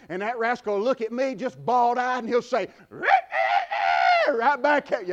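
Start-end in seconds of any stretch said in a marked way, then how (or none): 2.2-5.1 slow, drawn-out mock rooster crow; rooster crow imitation. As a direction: crow it like a rooster talking back. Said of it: "had your preacher crow".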